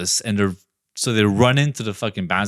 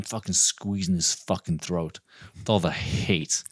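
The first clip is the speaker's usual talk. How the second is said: whisper; 4 semitones lower, 9 dB quieter than usual. A narrator's reading. Narrating strangling in whisper.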